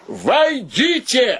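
high, slow call through the door. Acted. Podkhodtsev calling the guests in through the door. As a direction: sing out the invitation to enter. Podkhodtsev calls visitors to come in.

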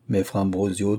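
usual speaking voice

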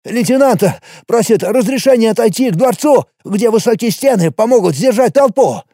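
high, panicked shouted report; urgent panting report. Acted. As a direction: blurt out the horror, breathless. Panicked messenger reporting zombie attack.

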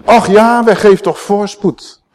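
high, loud, slow plea; fervent prayer. Acted. The preacher prays the psalm for rescue, high, loud and slow. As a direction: cry the plea out to God.

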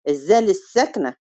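ordinary delivery

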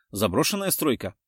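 plain reading voice

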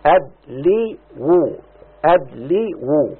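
syllable by syllable, sounding out each part; spelling out the sounds. Shown as a demonstration. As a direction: break the word into its sounds slowly. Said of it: "صارتا كده". (تقطيع الكلمة صوتًا صوتًا)

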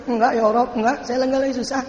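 lightly acted crying self-consolation; mock tearful self-talk. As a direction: insist through pretend tears, high and hurried. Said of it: "Sambil nangis tuh dia".

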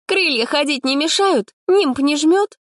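high mocking question; sarcastic jab. A narrator's reading. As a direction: needle the saint. Polina sneers at Sanya.

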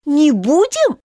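high, indignant exclamation; a shrill-voiced outraged mouse; outraged cry. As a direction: cry out in disbelief. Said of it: "вскричала мышь".

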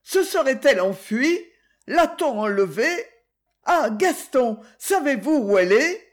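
anxious wailing. Acted. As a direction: plead in rising alarm. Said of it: "gémissait la baronne".

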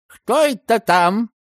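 put-on high, slow old gatekeeper's voice; muffled old woman. A narrator's reading. Old gatekeeper calling through the gate, high and muffled. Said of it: "глухо раздалось из-за калитки".

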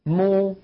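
مو with the lips rounded. Shown as a demonstration. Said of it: "انضمام للشفتين".

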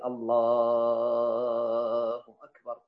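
"الله أكبر" drawn out very slowly and sung, every vowel held long; stretched takbir. Shown as a demonstration. Teacher shows students a stretched takbir that keeps its meaning, sung very slowly. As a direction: chant "الله أكبر" as one long, slow, sung call. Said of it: "لا يتغير المعنى به مثل".

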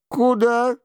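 high-pitched short question; lightly voiced king, pitched high. A narrator's reading. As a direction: ask it in a high royal voice.

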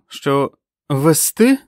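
sharp, high challenge; cold question. A narrator's reading. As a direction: draw it out loud in cool disbelief. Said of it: "холодно спитала Френ". (холодне, різке запитання)

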